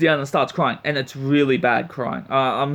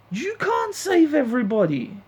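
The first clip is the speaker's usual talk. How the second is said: higher, forceful and incredulous; exasperated scold; 10 semitones higher than usual. Real, not acted. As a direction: hammer the truth at her. Ranting at Ruby.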